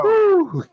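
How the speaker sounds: high, falling "whew". Real, not acted. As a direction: let out a high-pitched whew. Reacting to a risqué topic.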